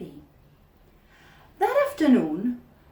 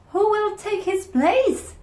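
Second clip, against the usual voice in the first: put-on much higher, anxious children asking; high kid voices; 12 semitones higher, 6 dB louder than usual. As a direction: voice the worried kids up high. Kids ask about coach.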